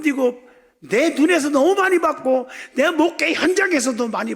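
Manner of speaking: high, loud, sustained exhortation; full-force preaching. Real, not acted. Preacher urging congregation to move God's heart, impassioned loud preaching. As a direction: drive each question home to the congregation.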